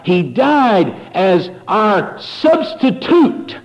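rising to a high, ringing preacher's cry, slow; preaching at full force. Real, not acted. Preacher to congregation, building to a high slow cry. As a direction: drive it home from the pulpit, climbing high.

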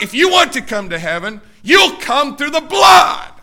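preacher shouting God's rule at the church; shouted preaching. Real, not acted. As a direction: thunder it out slowly. Voicing God, then shouting.

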